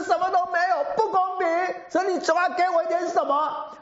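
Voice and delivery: high, tearful wailing plea; crying complaint. Retold or imitated. Widow crying to Elder Wu about no gifts, high tearful wail. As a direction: sob out the grievance in a high voice. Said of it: "一面哭一面讲".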